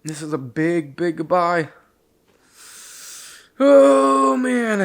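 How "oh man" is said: crying out. Real, not acted. Overwhelmed by the goodbye, crying out. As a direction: sob breaking through, higher and louder. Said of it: "gotta get it together".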